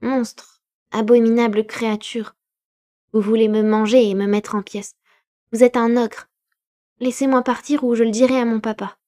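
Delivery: put-on high, loud, desperate boy's voice; terrified boy shouting at the creature; frightened child. A narrator's reading. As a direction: scream it like a panicked child fighting free. Terrified boy insults the monster.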